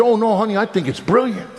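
husband brushing off wife's worry; eager insistence. Retold or imitated. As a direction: wave off her doubt cheerfully.

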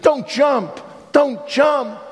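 high-pitched, urgent call to stop; urgent plea. Acted. Patrolman running to a man on a bridge, urgent shout. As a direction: call out in alarm to someone on the edge.

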